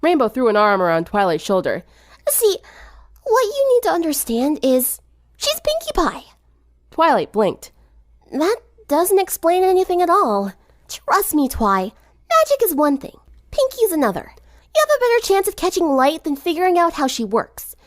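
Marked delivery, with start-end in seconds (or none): 2.3-6.2 put-on a high, knowing girl voice; sporty girl setting a friend straight; light tomboy voice, matter-of-fact; lightly voiced Rainbow. A narrator's reading. 8.3-17.8 put-on a high, knowing girl voice; sporty girl setting a friend straight; light tomboy voice, matter-of-fact; lightly voiced Rainbow. A narrator's reading.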